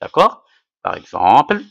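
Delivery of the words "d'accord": usual speaking voice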